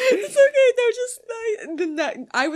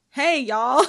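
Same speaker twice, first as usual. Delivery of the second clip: a loud Southern "Hey, y'all"; Southern accent; 7 dB louder than usual. Acted. A reader voicing the band in a Southern accent. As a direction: greet in a mock Southern drawl. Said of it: "I'm gonna make them Southern".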